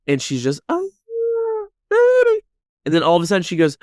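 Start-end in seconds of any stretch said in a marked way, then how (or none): 0.7-2.4 squealed very high and loud; broken, hammed-up wailing squeal; imitated hysterical wail. Kat screaming about this baby, mock hysterical wail. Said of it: "She's screaming about this baby".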